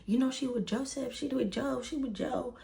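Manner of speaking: high, hushed mock tirade, repeating the accusation; going off. Mom accusing daughter to brother, mock going off. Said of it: "she was going off".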